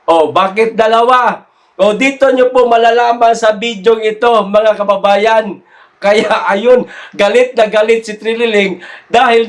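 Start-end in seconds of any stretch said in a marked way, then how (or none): none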